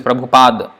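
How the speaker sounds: "Prabhupada" with the final a dropped; Bengali Prabhupada. Shown as a demonstration. Teacher showing students the Bengali form, clear. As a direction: clip the last a off "Prabhupada". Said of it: "it's pronounced like this".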